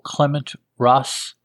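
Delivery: usual speaking voice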